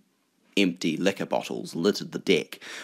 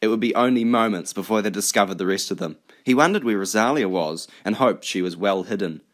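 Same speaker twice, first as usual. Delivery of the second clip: plain reading voice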